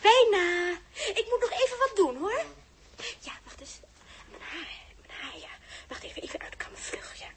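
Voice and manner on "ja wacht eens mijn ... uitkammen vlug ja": quick hushed whisper to herself; busy little whispered asides. Acted. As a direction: whisper while fussing with her hair. Hurried private primping, whispered.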